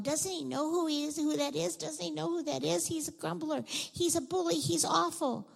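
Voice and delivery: high-pitched indignant complaining; grumbling crowd. Acted. Townspeople objecting to Jesus's visit, grumbling. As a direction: complain in a high, scandalised run. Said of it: "again, all the people are going".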